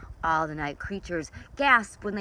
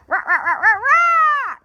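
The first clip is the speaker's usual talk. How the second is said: high, loud laughing rah squawks; loud parrot squawks; 12 dB louder than usual. Acted. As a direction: cackle and screech like a parrot. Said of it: "Papagayo was especially loud".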